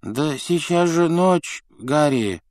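high, slow, drowsy complaint; half-asleep grumbling; sleepy mumble. A narrator's reading. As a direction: mumble groggily, dragging the words. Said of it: "неразборчиво пробормотал Рон".

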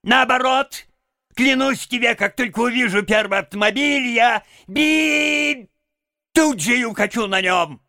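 high, loud, exuberant Toad; cheerful defiance. A narrator's reading. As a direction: vow it gleefully, building to a shout. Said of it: "весело откликнулся Жаби". (весело и восторженно, высоким голосом)

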